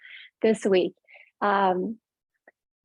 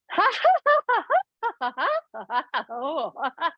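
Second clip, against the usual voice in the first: higher, varied rolling laughter; hearty exercise laugh; 6 semitones higher than usual. Shown as a demonstration. Teacher recalling a funny film, hearty laughter. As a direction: laugh freely as at something funny.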